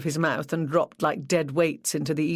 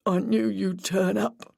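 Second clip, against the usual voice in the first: slow, shaky, regaining control; steady yourself but let it shake; 4 semitones higher, slower than usual. A narrator's reading. Grieving boyfriend regaining composure to answer police, shaky voice. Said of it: "in a shaky voice".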